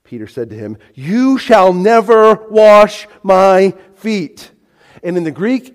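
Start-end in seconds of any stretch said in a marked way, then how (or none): 0.9-4.5 loud, higher, slow word-by-word refusal; very emphatic. Acted. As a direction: lay down each word forcefully. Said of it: "it's very emphatic".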